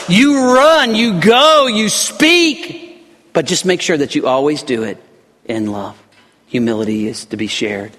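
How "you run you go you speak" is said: loud, high commanding call; the Lord commanding. Acted. Preacher voicing the Lord's order to go, loud command. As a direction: bark the orders like a sudden command. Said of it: "snaps that bridle and says".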